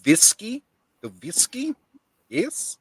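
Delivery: put-on Eastern European accent with rising questions; muppet-like foreign accent. Streamer questioning whiskey, muppet-like Eastern European accent. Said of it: "You talk like a muppet".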